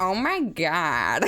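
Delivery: slow, stretched-out "Oh my God"; hammed-up fan exclamation; fangirl voice. Shown as a demonstration. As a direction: demonstrate a gushing fangirl drawl. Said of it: "we're gonna have a little voice practice here".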